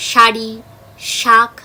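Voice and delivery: শাড়ি and শাক with a loud, clear sh; tongue on the palate; teacher demonstrates the palatal s. Shown as a demonstration. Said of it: "ভালো করে তোমরা দেখো".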